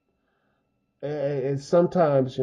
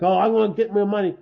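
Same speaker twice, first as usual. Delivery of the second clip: fast, a bit higher mock yell; mild mock yell; 5 semitones higher, 4 dB louder, faster than usual. Robber yelling for money beside him, fast mild mock yell. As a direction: ham up a robber shouting for his cash, quickly. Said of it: "He's yelling like".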